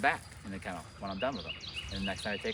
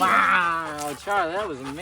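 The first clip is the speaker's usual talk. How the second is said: higher and louder, thrilled; excited praise; 8 semitones higher, 8 dB louder, slower than usual. Real, not acted. Trainer praising Charlie, higher and louder excited praise. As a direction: gush over the dog. Cheering dog's success.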